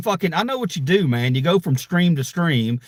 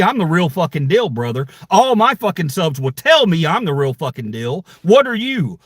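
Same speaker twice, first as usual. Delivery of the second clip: normal talking voice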